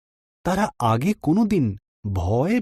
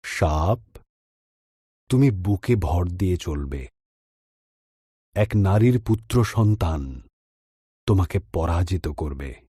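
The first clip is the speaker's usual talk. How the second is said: lower, solemn sentencing voice; God pronouncing judgement; 4 semitones lower than usual. A narrator's reading. God cursing the serpent, Eve and Adam, low and solemn. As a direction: hand down each curse gravely. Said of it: "ঈশ্বর বললেন".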